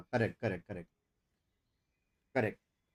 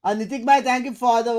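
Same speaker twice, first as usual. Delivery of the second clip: excited thanks; 9 semitones higher, 10 dB louder than usual. Real, not acted. Thanks member loudly on stream.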